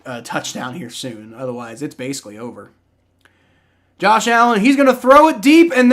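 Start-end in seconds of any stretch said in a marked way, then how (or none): 4.0-5.9 excited play call. Real, not acted. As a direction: call the long pass with rising excitement. Calling a deep throw live.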